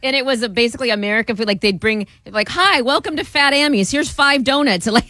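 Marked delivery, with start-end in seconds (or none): 2.5-4.9 cheery waiter voice. Retold or imitated. Fake British waiter greeting.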